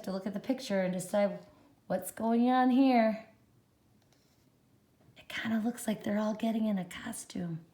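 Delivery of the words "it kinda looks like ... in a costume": soft fast whisper. Real, not acted. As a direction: whisper it like a secret. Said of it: "whispered aside".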